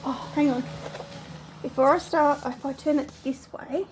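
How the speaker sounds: ordinary delivery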